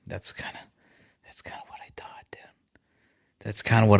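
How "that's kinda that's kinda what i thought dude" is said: barely audible, voiceless murmur; near-whisper. Real, not acted. Streamer to a silent challenger, near-whisper. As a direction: say it very softly, almost to yourself. Muttering before saying it aloud.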